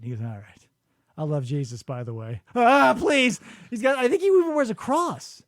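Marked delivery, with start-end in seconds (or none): 0.2-2.4 mock quiet confession. Retold calm admission.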